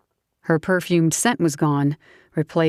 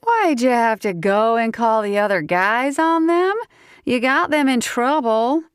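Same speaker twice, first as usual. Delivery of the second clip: put-on southern accent; 8 semitones higher than usual. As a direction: whine it in a strong southern accent. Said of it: "her southern accent suddenly came out stronger".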